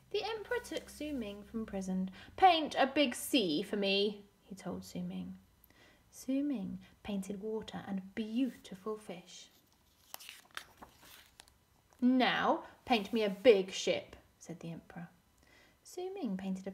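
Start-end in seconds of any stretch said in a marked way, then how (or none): 2.4-4.2 loud, forceful order; commanding emperor. Acted. Emperor demanding a painted sea of Su Ming, commanding. 12.0-14.2 loud, slow, insistent order; commanding emperor. Acted. Emperor demanding a ship from Su Ming, commanding.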